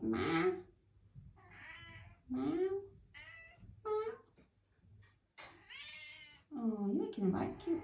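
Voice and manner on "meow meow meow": imitated meows. Meowing at the cat.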